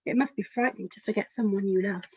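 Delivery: ordinary delivery, nothing marked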